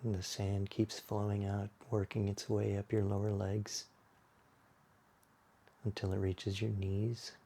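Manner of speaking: unhurried, hushed yoga-class body scan; guided meditation. Acted. Leads a guided relaxation.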